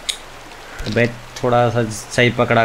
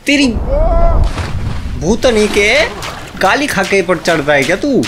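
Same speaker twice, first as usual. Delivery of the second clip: exasperated cursing; 8 semitones higher than usual. Real, not acted. Cursing at game character.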